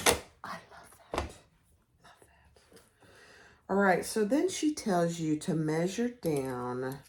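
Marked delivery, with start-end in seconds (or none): 0.1-2.8 unvoiced, appreciative whisper; real whisper of approval; whispered aside. Real, not acted. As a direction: whisper your approval, as if to yourself. Gushing aside about the pattern.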